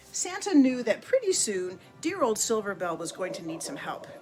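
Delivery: ordinary delivery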